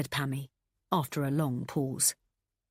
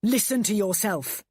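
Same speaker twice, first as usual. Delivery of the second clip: yelled; 4 semitones higher, 5 dB louder than usual. A narrator's reading. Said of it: "he yelled".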